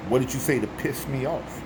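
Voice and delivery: normal talking voice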